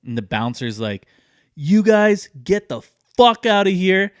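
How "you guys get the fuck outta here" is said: loud, higher, slow bouncer yell; angry bouncer. Retold or imitated. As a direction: throw them out like a furious bouncer. Bouncer throwing them out.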